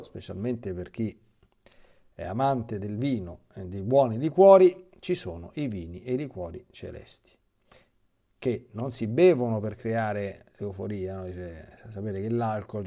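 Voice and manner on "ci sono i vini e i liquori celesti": usual speaking voice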